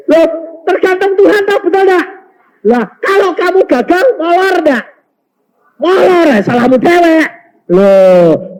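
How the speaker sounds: high, loud pulpit challenge; forceful preaching. Real, not acted. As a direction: drive the questions home to the congregation.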